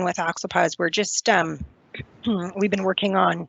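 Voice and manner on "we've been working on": hoarse voice, clearing throat; raspy, voice giving out; losing voice. Real, not acted. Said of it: "sorry, I'm losing my voice".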